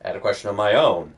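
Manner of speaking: lightly voiced Scottish mentor with a bad accent; terrible Scottish accent. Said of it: "There's my terrible Scottish accent".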